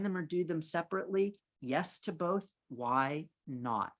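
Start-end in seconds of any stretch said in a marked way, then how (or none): none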